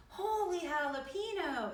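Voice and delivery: high-pitched playful exclamation; exclaiming. A narrator's reading. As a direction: cry out in theatrical amazement. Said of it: "exclaimed Skippy John Jones".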